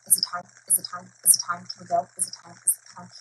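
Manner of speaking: hushed, rapid-fire mock kid nagging; imitating pestering child. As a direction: voice a child asking softly on repeat. Child pesters parent before preschool.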